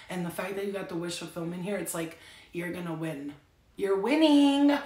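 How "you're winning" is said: loud, slow, high triumphant "winning"; Charlie Sheen winning. Pretend. Telling Capricorns they are winning, triumphant. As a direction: declare victory like a showman. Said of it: "You're like Charlie Sheen right now".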